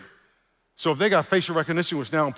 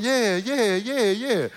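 mock agreeing; 7 semitones higher, much slower than usual. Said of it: "you stood there and you went".

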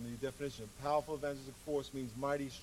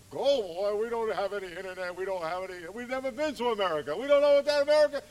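a hint of baffled disciples, slightly higher; doubting disciples; 5 semitones higher, 10 dB louder than usual. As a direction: voice the disciples' puzzled protest to Jesus. Disciples voiced doubting Jesus' plan.

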